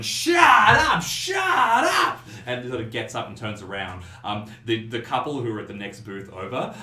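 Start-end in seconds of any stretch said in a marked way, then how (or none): 0.0-2.2 very loud, high-pitched shout; furious drunken bellow, pitched way up; yelling. Acted. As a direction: yell to silence screaming people. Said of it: "Jake is yelling".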